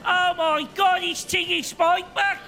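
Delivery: high, thrilled fan screams; fans screaming. Acted. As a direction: squeal it like starstruck fans.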